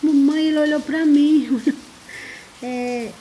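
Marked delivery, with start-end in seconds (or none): 0.0-1.6 mock crying child. Mother retelling her daughter's crying over a kitten, mock whine. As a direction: imitate the daughter's tearful complaint lightly. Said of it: "já vem chorando".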